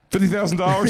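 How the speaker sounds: high, loud disbelief; shocked. Retold or imitated. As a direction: gasp out the price.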